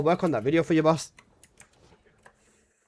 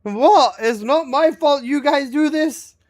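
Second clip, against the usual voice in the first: mock indignant; 7 semitones higher, 6 dB louder, slower than usual. Real, not acted. Mock outrage at chat.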